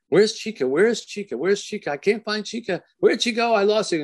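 mock searching. Father playing hide-and-seek with hidden daughter, mock alarmed searching. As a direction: pretend you can't find the hiding child. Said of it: "she would make you say".

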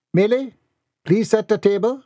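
high-pitched household command. Acted. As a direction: order the maid to the task. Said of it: "Missus Dixon commanded".

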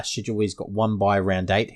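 ordinary talk, nothing marked

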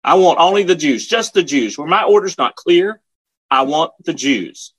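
high, insistent demand; angry officer's high-pitched order, slowing at the end; retold screaming major. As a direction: raise the pitch sharply, demanding obedience. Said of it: "screamed the major".

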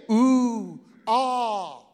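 breathy mock admiration; mock awe. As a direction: imitate gawking onlookers. Mocking courtiers admiring Solomon.

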